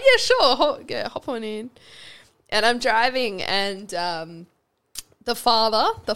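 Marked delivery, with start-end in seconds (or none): 0.0-1.7 cheery offer. Retold or imitated. Couple offering her a lift.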